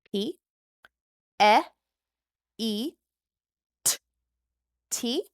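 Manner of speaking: "p, e, t" as separate sounds with pauses; sounding out phonemes. Shown as a demonstration. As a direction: say each phoneme alone, slowly.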